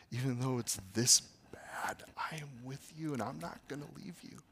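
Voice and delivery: soft unvoiced whisper; God whispering love to his child; gentle whisper. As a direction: lean in and whisper tenderly. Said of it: "the gentle whisper".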